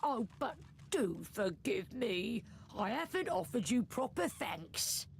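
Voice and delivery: insincere politeness. Acted. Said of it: "bows in insincere reverence".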